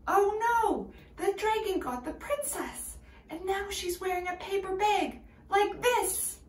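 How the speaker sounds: high, dramatic oh no; excited alarm. Acted. Teacher acting shocked for the kids. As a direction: gasp it out for the children.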